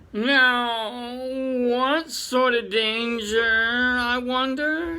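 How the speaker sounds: lightly played condescending chief; Chief Rabbit, lightly voiced. The Chief Rabbit humouring the young visitors, lightly voiced.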